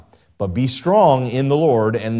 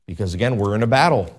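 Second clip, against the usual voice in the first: usual speaking voice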